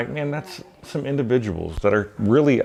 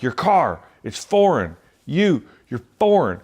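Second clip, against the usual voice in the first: frustrated yelling; 7 semitones higher than usual. Retold or imitated. Stranger in parking lot, mock angry rant. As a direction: mimic a bigot losing his temper. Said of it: "getting louder. And he's getting frustrated".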